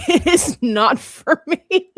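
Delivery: high, loud, drawn-out NOT FOR ME; laughing emphatic refusal. Retold or imitated. Repeats refusal, laughing, more emphatically.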